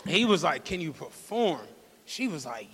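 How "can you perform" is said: businesslike coach. Retold or imitated. Pimp asks robbed girl to work, businesslike and low-key. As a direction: imitate a low, soft, matter-of-fact check. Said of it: "Said it like she was an Olympic gymnast".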